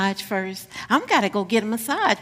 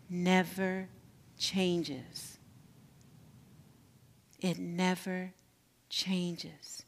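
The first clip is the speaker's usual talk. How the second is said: soft; 4 semitones lower, 13 dB quieter, slower than usual. Acted. Soft meditative close.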